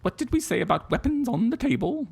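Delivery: high-pitched scolding mum with attempted Scottish accent; Merida's mother. Podcaster quotes a film mother's table rule, high scolding impression. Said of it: "Merida's mother from, uh, Brave".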